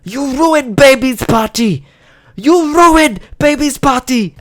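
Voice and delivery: put-on high, drawn-out accusing shout in a Singapore-English accent; shrill-pitched Singapore-English accent outburst. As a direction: imitate an angry mum wailing in Singapore-English accent. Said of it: "she repeatedly shouted".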